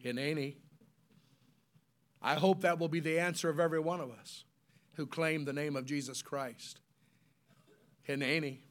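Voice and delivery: low, soft reply; quiet answer. Acted. Preacher answering God's call, low and quiet. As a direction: answer softly and humbly.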